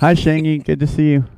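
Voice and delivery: excited greeting. Real, not acted. Greeting a viewer by name.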